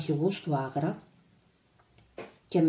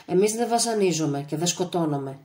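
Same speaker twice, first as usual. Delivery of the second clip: usual speaking voice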